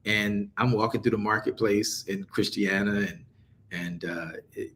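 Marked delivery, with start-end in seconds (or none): none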